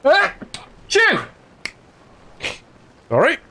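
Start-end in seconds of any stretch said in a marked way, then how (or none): 0.0-1.2 loud sudden sneeze. Real, not acted. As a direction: let out a big sneeze. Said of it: "Need to sneeze".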